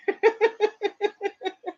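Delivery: long, high giggling laugh; real laugh. Real, not acted. Laughing at how much she talks, real giggle. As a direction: laugh helplessly at yourself. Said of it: "I just talk too much".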